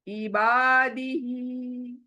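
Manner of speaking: इबादिही with बा and ही each held long; drawn-out vowels. Shown as a demonstration. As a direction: lengthen बा and ही in इबादिही, slowly. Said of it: "बा को अलिफ मद्दा की वजह से". (इबादिही में बा और ही को खींचकर)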